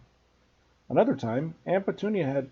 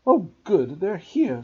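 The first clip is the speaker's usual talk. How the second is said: frantic; 5 semitones higher, 5 dB louder, slower than usual. A narrator's reading. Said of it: "said Aunt Petunia frantically".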